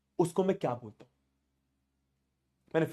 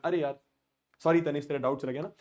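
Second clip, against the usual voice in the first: usual speaking voice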